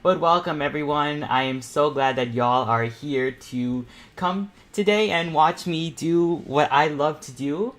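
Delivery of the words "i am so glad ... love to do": usual speaking voice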